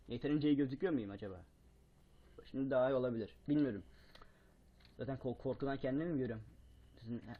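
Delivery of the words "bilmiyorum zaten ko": ordinary talk, nothing marked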